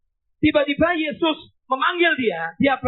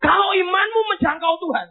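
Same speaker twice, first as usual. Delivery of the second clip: fervent cry; 6 semitones higher than usual. Real, not acted. Preacher pleading with the congregation, tearful shout. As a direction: cry it out from the heart.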